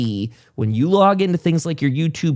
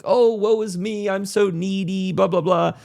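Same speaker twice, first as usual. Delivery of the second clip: mock whiny self-pity; 8 semitones higher than usual. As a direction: ham up a needy creator moaning. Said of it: "coming across like".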